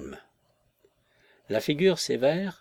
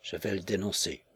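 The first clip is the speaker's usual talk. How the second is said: low, softer mutter through teeth; muttered through clenched teeth; 5 semitones lower, 7 dB quieter than usual. A narrator's reading. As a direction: grind it out under your breath. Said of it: "murmura entre ses dents serrées".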